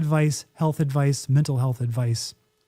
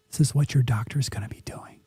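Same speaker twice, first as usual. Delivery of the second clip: conspiratorial unvoiced whisper; 4 semitones lower, faster than usual. Whispering a secret to her co-host. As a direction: let them in on a secret, whispered.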